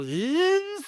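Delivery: high buzzing camera whir; imitated camera whir. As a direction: buzz like a film camera spinning up.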